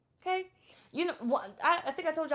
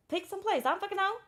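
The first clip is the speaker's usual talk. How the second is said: exasperated; 8 semitones higher than usual. Retold or imitated. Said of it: "And I'd be like".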